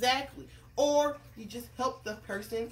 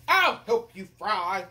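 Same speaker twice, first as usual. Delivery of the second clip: hungry king; 8 dB louder, slower than usual. Acted. Hungry king offers to fry, booming voice. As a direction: boom it out deliberately.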